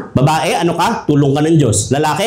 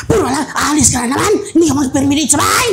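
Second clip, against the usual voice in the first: higher, mock scolding wife; nagging wife; 8 semitones higher than usual. Preacher imitating a wife nagging her jobless husband, mock scolding. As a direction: scold him like an exasperated wife.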